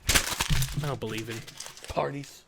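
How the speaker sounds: usual speaking voice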